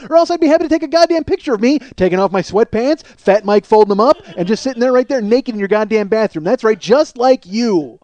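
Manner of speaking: heated comic defiance; indignant tirade. Acted. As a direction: keep railing at the hostess, loud and worked up. Imagined tirade continues.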